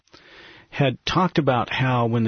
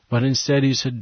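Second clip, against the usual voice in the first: usual speaking voice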